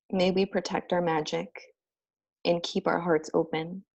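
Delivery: ordinary talk, nothing marked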